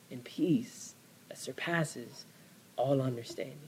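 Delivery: pastor whispering peace to the hurting; whispered. Real, not acted. Closing comfort to listeners.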